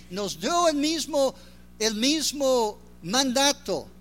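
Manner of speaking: preaching at full force. Acted. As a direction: preach it out to the congregation. Preaches the gospel call.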